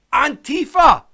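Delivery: loud, high-pitched punchline; incredulous reveal. Real, not acted. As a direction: belt out the astonishing reveal. Punchline revealed after wait for it.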